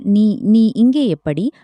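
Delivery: usual speaking voice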